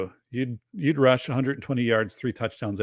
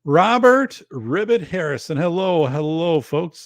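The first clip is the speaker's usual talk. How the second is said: louder, higher hello to a regular; big greeting to a chat regular; loud welcome; 5 semitones higher, 6 dB louder than usual. Real, not acted. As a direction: call out the name warmly.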